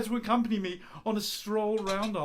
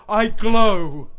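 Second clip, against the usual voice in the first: passionate recital; 11 dB louder than usual. Acted. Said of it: "lines I've been working on".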